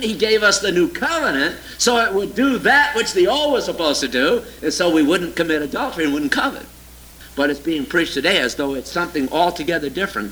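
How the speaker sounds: usual speaking voice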